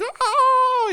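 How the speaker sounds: very high-pitched drawn-out cry; mock agonised wail. Comedian voicing octopus in stomach acid, mock agonised wail. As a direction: wail like a creature being burned, playfully.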